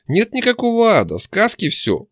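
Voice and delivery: high-pitched, snappish denial; angry denial. A narrator's reading. Demon denying hell to the hero, angry. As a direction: deny it crossly with voice lifted high. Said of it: "зло сказала чертовка".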